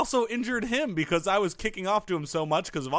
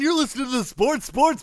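ordinary talk, nothing marked

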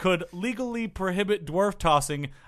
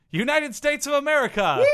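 higher, louder rallying announcer; hammed-up patriotic announcement; 8 semitones higher, 4 dB louder than usual. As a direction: announce the home country with mock fanfare. Hyped announcement of home country.

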